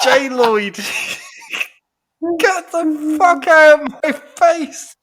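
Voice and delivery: loud, slow, outraged yell through laughter; hammed-up furious shout, laughing; mock angry flatmate. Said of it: "He went".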